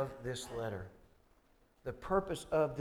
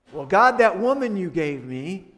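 loud, higher excuse-making; Adam blaming; 3 semitones higher, 11 dB louder than usual. Retold or imitated. Adam blaming God for the woman, loud whiny excuse. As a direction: pass the blame to God. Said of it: "what does Adam do?".

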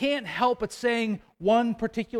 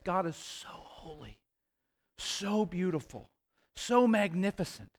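hushed awe; 6 dB quieter than usual. Real, not acted. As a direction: breathe it out in reverent wonder. Preacher dwells on God's holiness in awe.

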